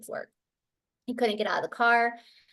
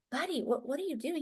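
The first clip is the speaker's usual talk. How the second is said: softer, higher-pitched question; gentle puzzlement; 5 semitones higher, 8 dB quieter, faster than usual. Retold or imitated. Mother to son at the bathroom door, quietly puzzled. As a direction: ask carefully, baffled.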